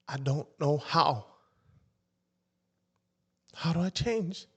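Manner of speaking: slow, high desperate plea; begging dad to show him how; pleading. Retold or imitated. Son asking his father how to change, pleading.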